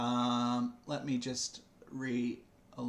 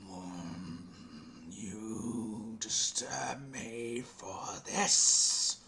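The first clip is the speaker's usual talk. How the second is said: slow, low, fading to a whisper; a dismissive god, trailing off; deep booming god voice; 4 dB quieter, much slower than usual. Acted. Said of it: "the deep voice continues and says".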